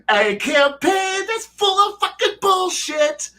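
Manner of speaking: very high, slow hammed-up wizard voice; over-the-top mock-mystical wizard, climbing high; Elminster. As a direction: finish the wizard's grand promise pitched far up and drawn out. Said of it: "Elminster come walking in there and be like".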